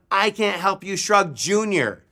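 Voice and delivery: usual speaking voice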